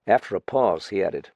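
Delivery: plain reading voice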